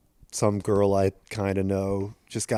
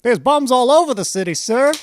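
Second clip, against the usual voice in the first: high, loud clipped report to a colonel; mock soldier report; 13 semitones higher, 4 dB louder than usual. Acted officer report scene.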